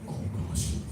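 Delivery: normal talking voice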